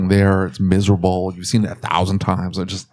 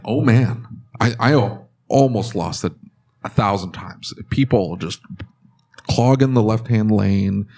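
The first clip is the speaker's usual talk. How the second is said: ordinary talk, nothing marked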